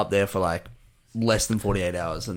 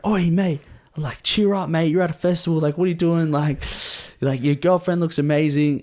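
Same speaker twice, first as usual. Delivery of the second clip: raised-pitch matey pep talk; cheery festival stranger; 6 semitones higher than usual. Retold or imitated. Stranger tapping a yawning guy's shoulder, high chummy urging. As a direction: imitate a bloke geeing up a tired mate.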